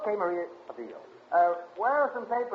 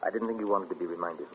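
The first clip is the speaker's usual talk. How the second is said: low, soft and hesitant; reluctant admission; 12 semitones lower, 9 dB quieter than usual. Acted. Husband gently telling wife about the execution, reluctant. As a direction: lower the voice and break it gently.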